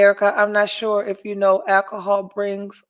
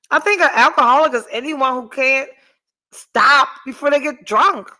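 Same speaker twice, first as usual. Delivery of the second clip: loud, higher-pitched, emphatic indignation; heated rant; 4 semitones higher, 6 dB louder than usual. Real, not acted. Woman telling viewers what an alcoholic is, heated rant. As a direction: push it out, incredulous and insistent.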